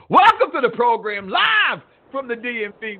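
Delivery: loud, high announcer's opening; radio show welcome. Real, not acted. Host welcomes listeners to the live program, in a loud announcer voice. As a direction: belt out the show's welcome, stretching the last words.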